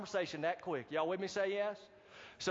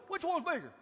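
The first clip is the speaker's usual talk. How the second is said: high, odd put-on voice on Which one's bigger; funny put-on voice; 9 semitones higher than usual. Preacher mocking his own voice while demoing to the crowd, silly high voice. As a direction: squeak it out in a silly voice. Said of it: "I don't know why I talk like that".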